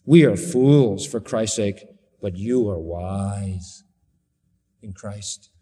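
low, slow mock deference; sarcastic. Preacher as Paul comparing apostles with Corinthians, sarcastic. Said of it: "watch how sarcastic he gets".

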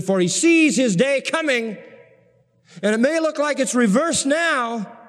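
high, ringing pulpit voice; soaring sermon delivery; preaching at full force. Acted. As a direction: drive the promise home to the congregation. Preacher quoting psalm fervently.

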